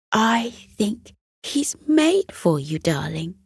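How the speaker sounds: tender mother. Acted. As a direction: reassure her son lovingly. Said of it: "said Elliot's mother".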